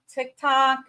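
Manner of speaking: ordinary talk, nothing marked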